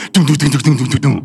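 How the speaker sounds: rapid thudding "dum" sounds; imitated tumbling noise. Parent imitating someone falling down stairs, thudding tumble. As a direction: bump down the stairs with your voice. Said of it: "I hear something go".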